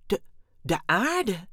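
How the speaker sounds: slow, stunned stammer on the first word. A narrator's reading. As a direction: repeat it haltingly in disbelief. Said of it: "stamelde Dexter". (verbijsterd stamelend)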